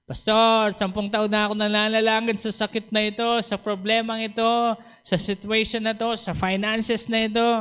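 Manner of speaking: high, listing mock complaint; acted lament. Preacher voices a member praying ten years, plaintively complaining.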